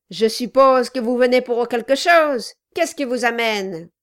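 loud, gruff dwarf. A narrator's reading. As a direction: bark it like a surly dwarf. The dwarf's voiced lines.

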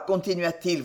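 ordinary delivery

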